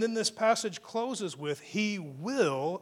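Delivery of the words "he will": "will" drawn out with weight; stress on will. Shown as a demonstration. Preacher quoting scripture to the congregation, stress on "will". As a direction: lean on "will" as a certainty. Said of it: "catch the emphasis on the word will".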